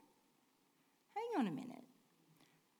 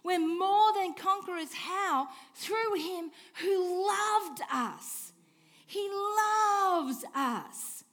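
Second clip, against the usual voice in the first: louder, slow, each word pressed home; emphatic preaching; 5 semitones higher, 5 dB louder, slower than usual. Real, not acted. Pastor stressing the verse to the congregation, loud and slow. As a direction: drive it in slowly and loud. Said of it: "See the emphasis".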